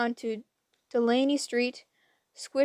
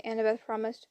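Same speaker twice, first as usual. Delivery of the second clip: ordinary delivery, nothing marked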